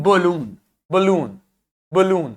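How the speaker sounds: "बलून" with a quick weak first vowel and full oo; full long oo. Shown as a demonstration. Teacher drilling balloon for students, "बलून" with reduced first vowel, full oo. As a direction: repeat "बलून", leaning on the long oo. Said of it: "ओ को आपने पूरा ऊ pronounce किया".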